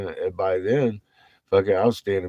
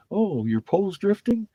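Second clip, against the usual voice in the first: much higher sarcastic mock voice; 14 semitones higher, 3 dB louder than usual. Mocking people who say the pole is drifting, sarcastic. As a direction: parrot the claim with scorn.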